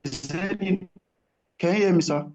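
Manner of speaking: ordinary talk, nothing marked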